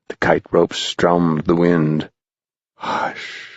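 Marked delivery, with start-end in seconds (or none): 2.8-3.5 soft voiceless hush; whispered hush. Acted. The whole kite hushing, soft whisper. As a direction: breathe it like the wind. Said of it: "said the entire thing".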